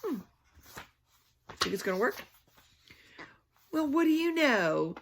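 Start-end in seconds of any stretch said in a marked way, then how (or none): none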